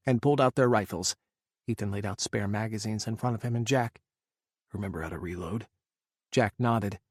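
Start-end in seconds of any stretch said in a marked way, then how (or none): 4.7-5.7 quiet. A narrator's reading. Quiet question during a tense firefight.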